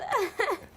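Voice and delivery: a quick high laugh; brief giggle; short laugh. Real, not acted. Laughing at the question.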